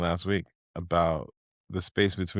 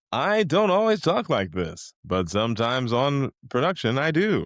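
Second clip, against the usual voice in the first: raised, hammed-up announcer delivery; 5 semitones higher than usual. Shown as a demonstration. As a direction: play it like a fighting game announcer. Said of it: "That's my announcer voice".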